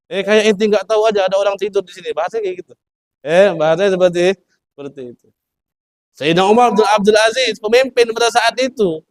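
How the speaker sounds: impassioned storytelling from the pulpit; preaching at full force. Acted. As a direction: preach it out to the congregation. Preaching story climax raised.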